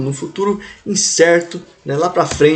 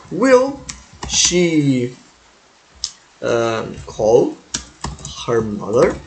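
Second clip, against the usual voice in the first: slow dictation; 3 semitones lower, much slower than usual. Shown as a demonstration. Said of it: "Will she call her mother".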